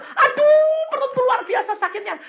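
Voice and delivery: high mock wail, starting with a loud moan; acted pain. Woman complaining of stomach pain, high-pitched mock wail. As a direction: imitate someone moaning over a terrible bellyache.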